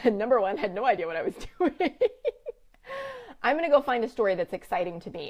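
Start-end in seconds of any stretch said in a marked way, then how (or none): 0.1-2.0 self-mocking chuckle breaking into the sentence; real laughing. Real, not acted. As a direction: laugh through the words. Laughing at own cluelessness.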